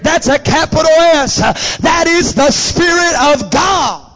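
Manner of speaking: loud, urgent exhortation; full-force preaching. Real, not acted. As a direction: drive each phrase out to the congregation. Preaches and declaims scripture.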